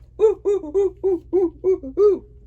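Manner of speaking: loud high hooting laugh; a happy gorilla hoot. Acted. Reading the flannel board, gorilla calling out, loud hoot.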